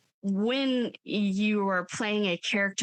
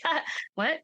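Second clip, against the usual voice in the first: mock shocked; 8 semitones higher than usual. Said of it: "He was like".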